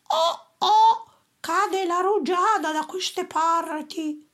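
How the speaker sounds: puzzled dupe feeling drops; thief voice. A narrator's reading. Thieves under the tree wondering at the drops, high comic voice.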